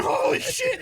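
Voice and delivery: high, loud stunned exclamation; mock shock. Him reacting to a stranger's heckle, shocked. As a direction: blurt it out aghast, hammed up.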